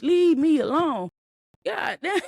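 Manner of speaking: acted blow-up at someone who won't stop pushing; mock outburst. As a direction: snap it out like you've had enough. Acting out snapping at someone.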